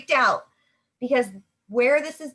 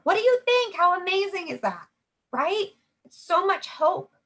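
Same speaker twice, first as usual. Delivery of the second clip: excited sales pitch; 8 semitones higher than usual. Shown as a demonstration. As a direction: gush about how amazing the story is. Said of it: "send it over".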